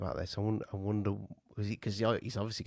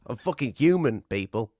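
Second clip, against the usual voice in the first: louder, venting frustration; exasperated outburst; 3 semitones higher, 8 dB louder than usual. Real, not acted. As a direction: vent it loudly. Outburst while salvaging the podcast.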